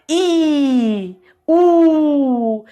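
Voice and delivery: "I," and "U." pushed out with effort; strained vowels. Shown as a demonstration. Teacher showing how hard these vowels are to say. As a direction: squeeze out "I," and "U." with force. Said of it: "experimenta falar aí, I e U".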